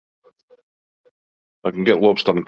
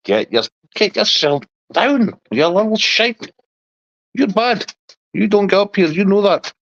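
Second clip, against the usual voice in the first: scolding the dog; 13 semitones higher than usual. Real, not acted. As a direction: tell the little dog off in a much higher voice.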